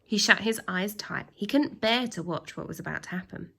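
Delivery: usual speaking voice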